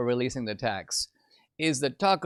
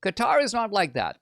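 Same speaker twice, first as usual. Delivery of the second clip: normal talking voice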